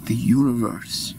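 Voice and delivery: low, slow, spaced-out phrases; calming. Acted. Peacemaker urging quarrelling friends to breathe, calming. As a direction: soothe them with long pauses to breathe.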